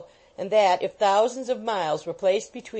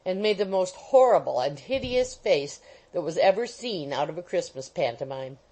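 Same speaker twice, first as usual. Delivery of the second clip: ordinary delivery, nothing marked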